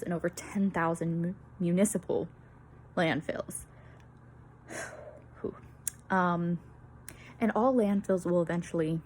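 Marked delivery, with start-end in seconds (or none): 5.4-5.6 a long, weary breathy exhale; tired sigh. Real, not acted. Presenter catching her breath mid-talk, tired sigh. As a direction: let out a tired whew to reset.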